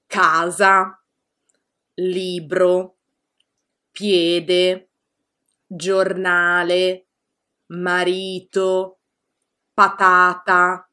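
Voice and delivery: each word said slowly and clearly, leaning on the second-to-last syllable; slow careful teacher word list; slow model pronunciation for learners; penultimate stress examples. Shown as a demonstration. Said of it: "esempi di parole con l'accento sulla penultima".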